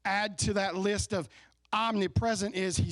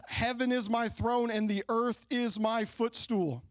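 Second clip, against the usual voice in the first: slow, raised scripture declaration; solemn scripture reading; 5 semitones higher than usual. Shown as a demonstration. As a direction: proclaim it slowly as the Lord. Reading the Lord's words.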